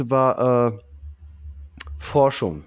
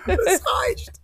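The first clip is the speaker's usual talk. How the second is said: high mock shout. Playful mock stop.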